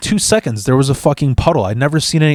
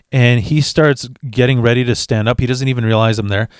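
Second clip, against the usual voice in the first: ordinary delivery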